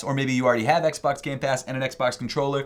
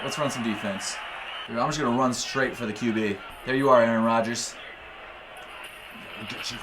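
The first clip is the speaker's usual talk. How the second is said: ordinary talk, nothing marked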